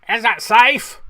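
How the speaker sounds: loud, high mock film-villain voice; Laurence Olivier. Host quoting a famous Marathon Man line, hammed-up impression. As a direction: shout it as an over-the-top movie impression. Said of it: "pretty good Oliver, Olivier impression".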